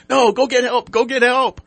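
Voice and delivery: yelling high and loud for help; desperate shout. Retold or imitated. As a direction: scream at the cat to go get help. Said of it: "He goes".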